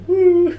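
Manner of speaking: loud mock fan "woo"; short high celebratory whoop; high cheering whoop. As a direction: whoop in fake sports enthusiasm. Mock cheer for generic sports team.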